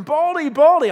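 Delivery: high, loud sing-song mocking chant; kids' taunt. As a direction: jeer at the bald prophet like a schoolyard bully. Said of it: "They're making fun of him".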